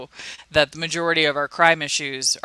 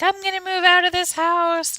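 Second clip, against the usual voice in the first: mock roommate; 12 semitones higher than usual. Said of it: "my poor roommate's like".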